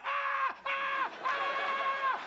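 high, sustained cartoon wail; mock fish scream. Fish being killed on a hook, mock screaming. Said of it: "they make noise".

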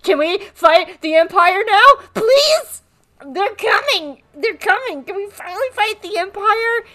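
very high, whiny begging; eager pleading. Retold or imitated. Echo begging Hunter to fight the Empire, high-pitched pleading. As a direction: beg like an overexcited child in a squeaky voice.

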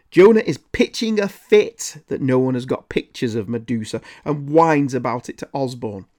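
normal talking voice

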